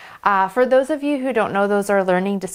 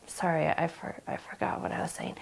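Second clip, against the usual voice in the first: real, very soft flustered apology; flustered near-whisper; 4 semitones lower, 11 dB quieter than usual. Real, not acted. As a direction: lose the thread and let the voice drop away. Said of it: "I'm kind of freaking out".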